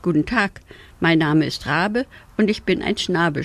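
ordinary delivery, nothing marked

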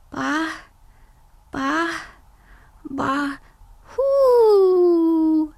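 falter, then squeak it out; unsure. Acted. Little ghost Gustav tries to howl, unsure and halting. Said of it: "sagte Gustav unsicher".

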